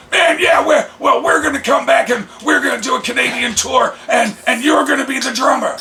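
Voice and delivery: high, loud hammed-up rocker shout; Corey's rocker voice. Corey offering the drummer spot, high and loud. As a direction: sell the tour like an excited rocker. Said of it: "And he's like".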